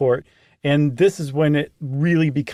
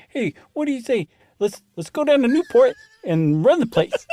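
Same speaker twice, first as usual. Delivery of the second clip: put-on Cleveland accent, pitched up; 8 semitones higher than usual. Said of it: "That's a Cleveland accent".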